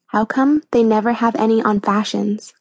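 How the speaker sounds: usual speaking voice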